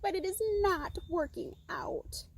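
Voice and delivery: high, plaintive bird voice sinking at the end; sad moan. Acted. Little bird admitting failure to the donkey, sad high moan. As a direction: lament it, trailing down. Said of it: "moaned the little bird quite sadly".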